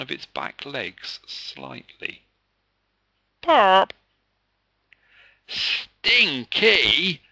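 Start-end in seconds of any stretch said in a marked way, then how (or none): 3.4-3.9 loud, high comic pop; a sudden silly pop sound; cartoon fart pop; popping noise. A narrator's reading. Dog breaks wind. 5.5-7.2 high, loud squeal of disgust; shrill schoolboy squeal at a bad smell; squealing boy. A narrator's reading. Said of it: "Sam squealed".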